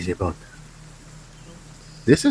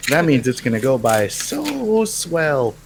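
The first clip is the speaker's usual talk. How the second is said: slow, chipper gee-whiz voice; sweet old-timey kid; wholesome 1950s kid; 6 semitones higher, 3 dB louder, slower than usual. Acted. Wholesome sarcastic cheer.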